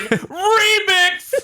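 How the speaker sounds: high-pitched hyped-up yell, laughing. Retold or imitated. Player retelling how they lost it over an audio glitch, yelling. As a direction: imitate yourself yelling in hysterics. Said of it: "I kept yelling".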